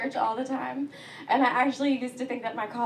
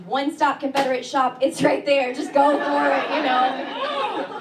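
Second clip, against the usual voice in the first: louder, punching one-stop Confederate shop; mock store slogan; 3 semitones higher, 8 dB louder than usual. A comedian mocking a roadside store's sign, loud and jingly. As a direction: sell "shop" like a proud jingle. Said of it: "they can't even make the rhyme".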